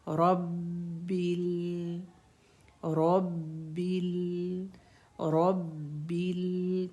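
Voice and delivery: रब्बिल with the doubled ब held a moment, slow. Shown as a demonstration. Teacher repeating रब्बिल to show the held shadda. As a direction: hold the ब in रब्बिल before releasing. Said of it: "थोड़ी देर hold करना है".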